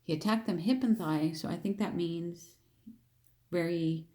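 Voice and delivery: normal talking voice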